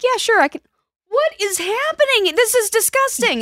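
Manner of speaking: imitating a mom walking into a messy room; shocked mom. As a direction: act appalled at the state of the room. Mom reacting to messy kid room.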